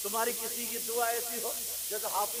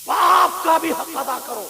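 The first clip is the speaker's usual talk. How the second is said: emphatic call; 8 semitones higher, 17 dB louder, slower than usual. Acted. Preacher rising to climax.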